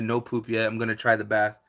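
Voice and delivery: ordinary talk, nothing marked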